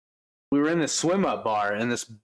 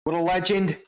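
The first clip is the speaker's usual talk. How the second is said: pitched-up mock Aussie; hammy Australian praise; Australian accent; 6 semitones higher than usual. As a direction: play an Aussie bloke, voice raised. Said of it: "maybe just do the Australian".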